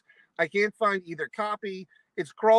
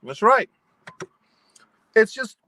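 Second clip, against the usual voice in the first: emphatic; 4 semitones higher, 9 dB louder than usual. Real, not acted. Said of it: "emphatic rant".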